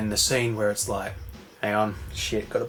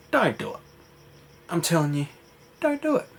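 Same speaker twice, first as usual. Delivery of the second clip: mock threat; 6 semitones higher than usual. Richie warning the gambler, rising mock threat. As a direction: pitch jumping up on the warnings.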